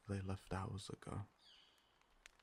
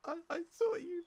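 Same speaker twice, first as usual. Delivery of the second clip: near tears of wonder, pitched way up; overjoyed. Acted. Overwhelmed to find the newcomer alive, high excited cries. Overwhelmed joy at finding them.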